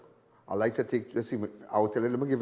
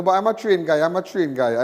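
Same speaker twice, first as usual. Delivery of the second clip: usual speaking voice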